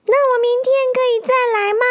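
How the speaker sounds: put-on high, quick childlike plea; squeaky-high hedgehog, excited; eager hedgehog kid. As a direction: beg to come back tomorrow.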